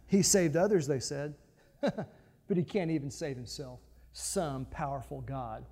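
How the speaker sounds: mock. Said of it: "mocked him amongst themselves".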